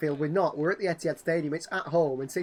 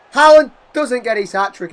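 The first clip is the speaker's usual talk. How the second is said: much louder, higher, a groan in it; excited miss call; 7 semitones higher, 17 dB louder than usual. Real, not acted. Gamer commentating Haaland's saved chance, loud and gutted. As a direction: call the near miss at full voice.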